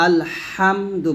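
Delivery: আলহামদু with a pressed throaty h, held long. Shown as a demonstration. Teacher correcting the mistake, with pressed ha. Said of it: "হাটা চাপ দিয়ে বলতে হবে".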